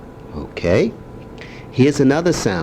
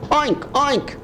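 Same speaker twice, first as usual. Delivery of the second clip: high oinking; pig oink; 12 semitones higher, 3 dB quieter than usual. Shown as a demonstration. Grandpa repeating the pig sound. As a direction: oink again for the child. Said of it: "Does that sound like a pig?".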